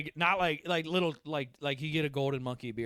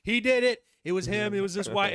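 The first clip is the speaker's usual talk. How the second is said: higher and louder, pointing the finger; mock accusing shout; 8 semitones higher, 9 dB louder than usual. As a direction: yell it out like tattling kids.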